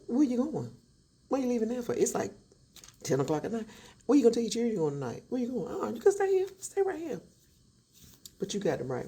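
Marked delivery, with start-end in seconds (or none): 0.1-7.2 wife jeers at husband leaving at night; mock sweet taunt. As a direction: tease him as he storms out.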